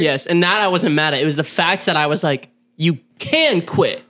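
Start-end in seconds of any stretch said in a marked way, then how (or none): 2.8-4.0 slow, emphatic "can"; insistent, stretched weight on "can"; stress on can. Retold or imitated. Retells his insistence, stressing can.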